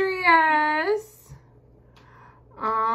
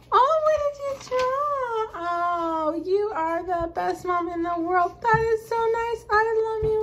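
much higher, gushing delight; 9 semitones higher than usual. Real, not acted. Mom gushes over her daughter's note, delighted and high. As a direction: melt over a sweet gift.

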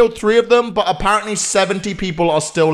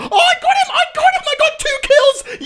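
very high-pitched victory yell; triumphant shriek. Real, not acted. Player celebrating a second kill, triumphant shriek. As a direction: squeal with excitement at the kill.

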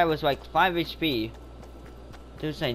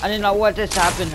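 fast, louder panicked rush; panicking; 4 semitones higher, 6 dB louder, much faster than usual. Real, not acted. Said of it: "maybe I'm panicking right now".

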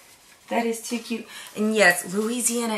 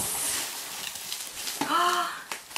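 breathy awed gasp; 9 semitones higher, 7 dB quieter than usual. Real, not acted. Opening a surprise item.

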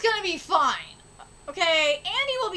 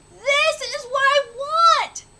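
excited shout; 6 semitones higher, slower than usual. Real, not acted. Streamer hyped by fire art.

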